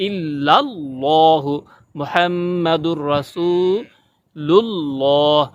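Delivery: slow, with আল্লাহ heavy and full; slow recitation with a heavy l; heavy Allah. Shown as a demonstration. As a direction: chant ইল্লাল্লাহু মুহাম্মাদুর রাসূলুল্লাহ slowly, thick l. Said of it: "আল্লাহ শব্দটাকে মোটা করে বলতে হবে".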